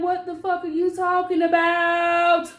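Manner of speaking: high, loud baffled outburst; can't believe what she's hearing; confused disbelief. Retold or imitated. Reacting to worried friends' texts, high and baffled.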